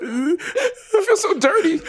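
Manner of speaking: high, laughing mock sob; hammed-up weepy whimper; mock crying. Ashamed glove after the deed, high mock sob. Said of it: "he just cried like".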